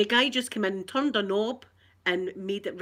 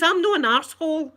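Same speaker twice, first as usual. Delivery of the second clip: loud, high indignant retort; wound up; 5 semitones higher, 7 dB louder than usual. Retold or imitated. Customer standing up to the engineer, wound up. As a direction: re-enact an angry insistence. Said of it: "I was wound up".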